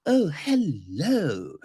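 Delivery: slow put-on drawn-out greeting. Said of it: "it's just like".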